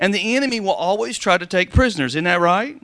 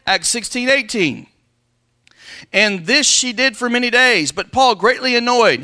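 scripture preaching; 3 semitones higher, 5 dB louder than usual. Acted. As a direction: proclaim the verses to the pews.

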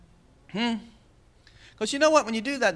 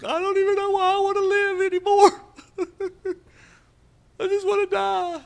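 much higher whiny despairing voice with a laugh; mock despair; 13 semitones higher than usual. Preacher imitating a despairing believer, high mock whine. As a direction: whine it high like a hopeless wreck.

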